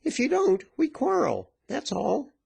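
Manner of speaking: very high-pitched threat; high, menacing ultimatum; looking daggers. A narrator's reading. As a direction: threaten him in a high virago voice. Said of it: "looking daggers".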